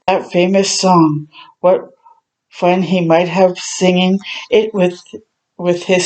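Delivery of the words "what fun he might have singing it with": usual speaking voice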